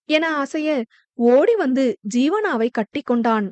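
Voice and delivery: ordinary delivery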